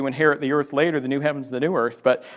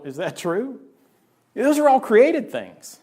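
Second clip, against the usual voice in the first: normal talking voice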